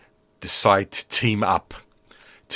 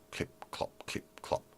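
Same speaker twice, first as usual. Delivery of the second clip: very soft whispered clip-clop; imitated footsteps; 15 dB quieter than usual. Storyteller mimics Jack's feet on the wooden bridge, hushed clip-clop. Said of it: "feet just clip-clopping on the wooden".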